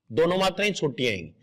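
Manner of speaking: ordinary talk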